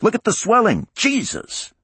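high, loud panicked yell. Acted. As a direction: shout it in alarm. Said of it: "One of the technicians yelled".

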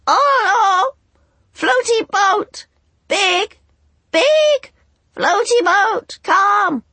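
very high, loud, slow squawks; clanging bird chorus. A narrator's reading. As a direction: clang it like rusty bells. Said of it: "voices clanging like rusty bells".

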